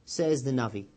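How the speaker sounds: ordinary talk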